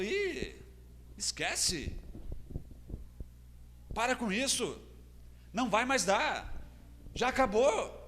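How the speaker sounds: lightly acted, high-pitched discouragement; dismissive naysayer. Discouraging people telling a believer to give up, dismissive. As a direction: wave it off in short, separate verdicts.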